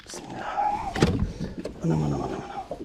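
breathless hushed whisper; tense whisper. Real, not acted. Tense moment removing the hook.

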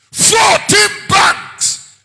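shouted, drawn out. Real, not acted. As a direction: bellow it slowly, high and loud. Preacher repeats with force.

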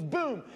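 short, high, loud boom; imitated explosion. Telling his nervous buddy what a popped cow does, loud boom. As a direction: burst it out like a small blast. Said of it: "He goes".

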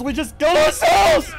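slow, high panicked shout. Real, not acted. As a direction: yell in desperation.

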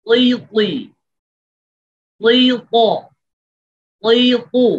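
high, loud emphatic ক ক drill; drilled letter pairs. Shown as a demonstration. Teacher drilling students on qaf with two vowels, emphatic. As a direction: bounce each syllable out for the class. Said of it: "এরপরে জের দিয়ে".

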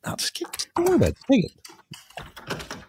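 strained, high whispery voice; mock urgent whisper. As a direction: imitate someone hissing to just take it. Man urging him to take it.

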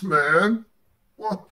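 usual speaking voice

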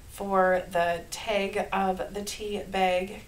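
slow, drawn-out Minnesota vowels; Minnesota accent. Shown as a demonstration. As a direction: lean into the Minnesota accent on tag and bag. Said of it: "Can you hear my Minnesota accent?".

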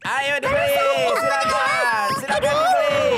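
hawking call. Acted. Father hawking goods at stall.

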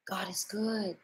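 breathy hushed whisper. Acted. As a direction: drop to a whisper of awe. Sudden whisper in prayer.

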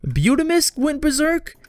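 can't believe it's happening again; high disbelieving squeak; disbelieving shock. Real, not acted. Another colonist goes berserk.

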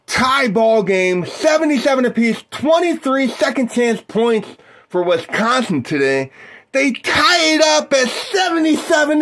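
excited play-by-play caller; sports announcer. Acted. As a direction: call the game like a TV announcer. Plays basketball play-by-play.

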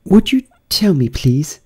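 put-on high, hesitant girl voice; Alice timidly questioning the gardeners; a little timid. A narrator's reading. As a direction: ask shyly. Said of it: "said Alice a little timidly".

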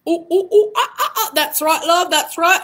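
high monkey puppet voice; Mister Matt. Acted. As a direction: hoot like a cheeky monkey. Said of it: "call out Mister Matt the monkey".

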